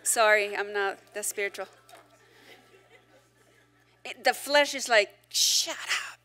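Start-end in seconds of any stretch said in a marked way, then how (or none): 5.3-6.2 voiceless, under the breath; whispered snap. Pretend. The flesh answering blunt people, whispered. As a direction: hiss it to yourself.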